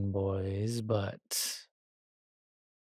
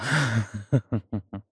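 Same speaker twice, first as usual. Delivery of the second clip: pleased chuckle; 5 dB louder than usual. Acted. Vampire hears darling consent, pleased chuckle.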